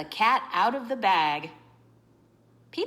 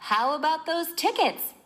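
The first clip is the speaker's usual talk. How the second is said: character question; 8 semitones higher than usual. Acted. Trish asks about tickets.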